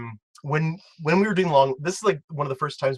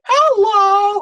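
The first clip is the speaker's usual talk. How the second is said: very high, loud drawn-out hello; piercing truck-voice impersonation; imitated truck yell; 9 dB louder than usual. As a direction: holler it like the passing truck. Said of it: "and it went".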